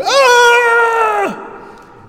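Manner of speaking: loud, high-pitched scream; waking scream. Acted. Saul waking with a spear through him, screaming. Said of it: "He's gonna wake up and go".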